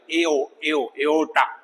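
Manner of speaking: "एओ, एओर्टा" with A and O sounded separately; separate vowel sounds. Shown as a demonstration. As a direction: keep A and O distinct in "एओर्टा". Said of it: "अलग-अलग sound है".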